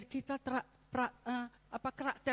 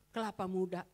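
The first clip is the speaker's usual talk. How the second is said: ordinary talk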